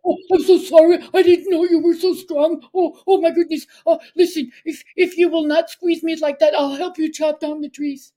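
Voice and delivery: high, quavering troll plea; frightened pleading. Acted. Troll begging not to be squeezed, frightened. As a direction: plead in hammed-up panic.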